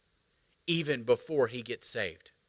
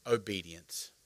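reluctant; 8 semitones lower, 10 dB quieter, slower than usual. Pretend. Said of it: "oh, we hate this word".

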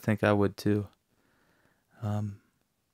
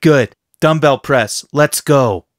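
loud, raised-pitch gym orders; commanding trainer; 4 semitones higher, 7 dB louder, slower than usual. Acted. Trainer sending God to the dumbbell press, loud and commanding. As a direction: fire off orders to get moving.

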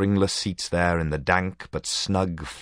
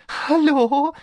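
sniggering; 7 dB louder than usual. A narrator's reading.

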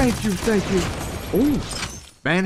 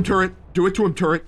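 ordinary delivery

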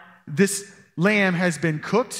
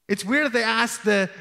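ordinary delivery

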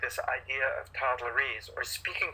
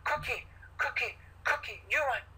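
put-on higher, short repeated baby phrases; toddlerese; 10 semitones higher than usual. Shown as a demonstration. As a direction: mirror the toddler's words. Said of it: "You might say something like".

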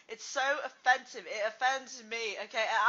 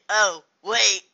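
ordinary delivery

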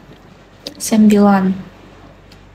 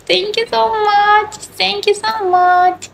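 gushing thanks; 9 semitones higher than usual. Real, not acted. Thanking viewers for compliments.

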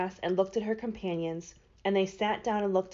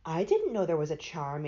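plain reading voice

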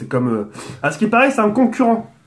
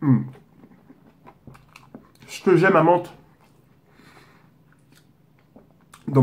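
talking while chewing; chewing between words; mouth full of burger; 5 semitones lower than usual. Real, not acted. Seen 0: Bites burger at -5, still chewing with lips pursed early on.

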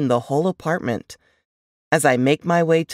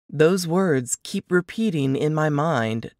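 ordinary delivery

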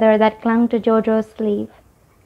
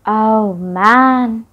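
amazed; 4 dB louder than usual. A narrator's reading. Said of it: "Rico's mouth fell open".

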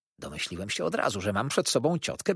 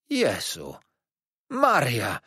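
loud astonished exclamation; startled cry; 4 dB louder, much slower than usual. A narrator's reading. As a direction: cry out in shock at the news. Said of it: "zawołała". (zdumiony okrzyk ciotki)

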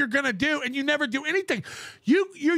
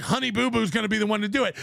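rushed mock quote; 6 semitones lower, much faster than usual. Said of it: "go like".

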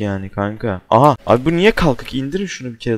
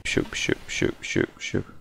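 hushed whisper to himself; scared whisper; 11 dB quieter, much faster than usual. Real, not acted. Player sneaking past the monster, whispering to himself. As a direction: whisper so the monster won't hear. Hiding from monster, calming self.

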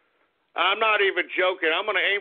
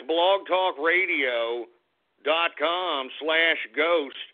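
slow and deliberate; 3 semitones lower, much slower than usual. Real, not acted. Spelling out the web address.

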